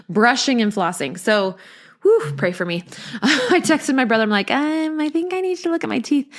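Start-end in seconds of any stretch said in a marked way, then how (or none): 4.5-6.3 high, hesitant little plea; mock-timid, high-pitched ask; sheepish request. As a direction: ask awkwardly in a raised, small voice. Acting out her text to brother.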